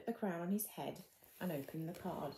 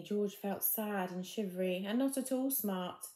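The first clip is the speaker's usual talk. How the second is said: plain reading voice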